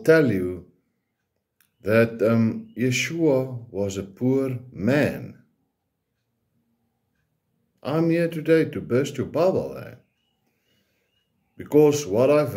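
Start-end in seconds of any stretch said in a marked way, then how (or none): none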